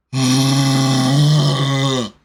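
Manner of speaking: long, loud exasperated sigh; heavy sigh. Retold or imitated. Hungry patient denied food, sighing loudly. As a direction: let out a big miserable groan of a sigh.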